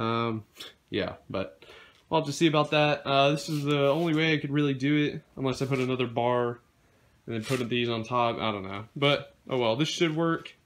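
ordinary talk, nothing marked

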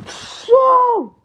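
high, loud drawn-out cry; battle yell. Acted. Player strikes in battle, loud high yell. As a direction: yell it as an attack.

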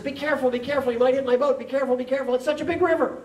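high, loud calling out across water; acted alarmed farmer shouting; shouted warning. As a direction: yell an urgent warning to another boat. Farmer calls warning to boat.

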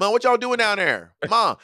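high-pitched call, words kept distinct; corrected family call. Shown as a demonstration. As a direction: show how you really call Mom downstairs. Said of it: "I say".

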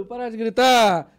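loud, drawn-out scolding shout; acted-out fed-up passenger calling out; imitating an angry complainer. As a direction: yell it so the whole cabin hears. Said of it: "Tentando chamar a atenção de outros".

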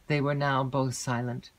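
ordinary delivery

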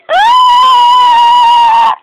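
long, very high, loud shriek; mock horror scream. Shown as a demonstration. Kid explaining the horror movie, long mock scream. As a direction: hold a piercing pretend scream.

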